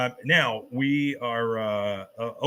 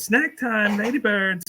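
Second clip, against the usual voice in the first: sing-song calling; 9 semitones higher, slower than usual. Retold or imitated. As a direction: call the dog sweetly. Luann calling the dog.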